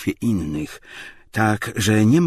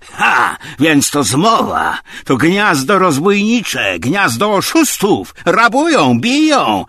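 loud, high alarm, building; outraged outcry; 10 semitones higher, 10 dB louder, slower than usual. A narrator's reading. Tarantiew accuses the house of conspiracy, outraged outcry. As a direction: cry out slowly, then higher still. Slapped man cries conspiracy and robbery.

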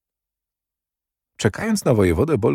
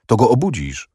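mildly impatient order, pitched higher; higher-pitched, testy retort; 4 semitones higher than usual. A narrator's reading. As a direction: give a short order with a touch of impatience. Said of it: "odparł niecierpliwie".

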